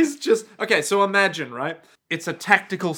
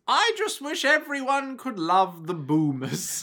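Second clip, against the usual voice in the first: wistful villain; 6 semitones higher than usual. Retold or imitated. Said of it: "Why can't they love boomers".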